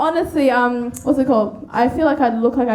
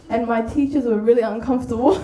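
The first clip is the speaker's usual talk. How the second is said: ordinary talk, nothing marked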